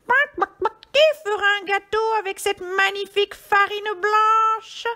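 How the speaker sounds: high, loud squawky hen; little hen voice. A narrator's reading. Red hen asking who will bake a cake, high hen voice. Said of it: "demanda la petite poule rousse".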